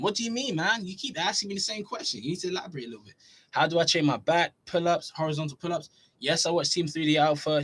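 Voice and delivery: ordinary talk, nothing marked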